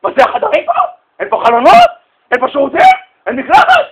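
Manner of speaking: high, loud indignant yelling; complaining shout. Retold or imitated. Hotel guest yells at staff about the tiny room, shouting. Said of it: "התחילה לצעוק".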